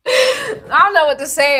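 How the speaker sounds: laughing near tears; too amused to speak; laughing helplessly. Real, not acted. Lost for words about men, laughing helplessly. Laugh-crying after absurd story.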